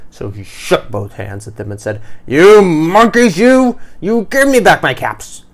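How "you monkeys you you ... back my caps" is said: storybook peddler yelling at monkeys; very angry. A narrator's reading. Peddler demanding caps from monkeys, shouting angrily. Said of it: "This made the peddler very angry".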